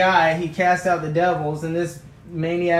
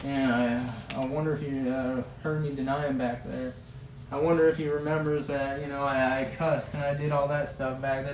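guilty Peter; 3 semitones lower, 5 dB quieter than usual. Acted. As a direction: lower and softer, ashamed murmur. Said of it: "Peter's sitting off in the corner like".

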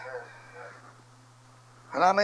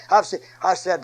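ordinary delivery

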